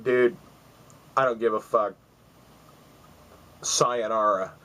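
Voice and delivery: retold cocky shrug-off; a hint of tough-guy swagger; dismissive parting line, understated; attitude. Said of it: "kinda, kinda attitude".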